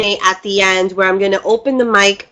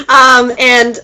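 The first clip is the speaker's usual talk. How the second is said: laughing; 5 semitones higher, 4 dB louder than usual. Real, not acted. Bursts laughing on camera.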